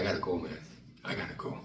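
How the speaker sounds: low, soft, fading to a whisper; pleading to leave in fear; frightened mumble to Troy, trailing to a breath; scared. Retold or imitated. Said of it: "I said".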